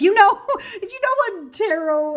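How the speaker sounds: high laughing words breaking through. Real, not acted. Laughs at her own wording.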